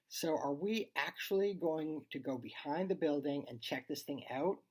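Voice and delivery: plain reading voice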